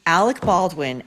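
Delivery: ordinary talk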